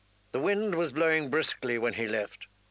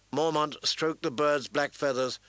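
usual speaking voice

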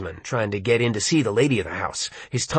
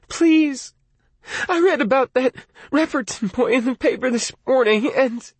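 desperate plea; 11 semitones higher than usual. Acted. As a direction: beg him urgently. Lee begging Arnie.

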